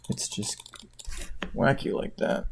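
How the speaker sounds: hushed, half-voiced irony; whispered aside. Real, not acted. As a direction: drop to a whisper for the punchline. Trailing ironic aside.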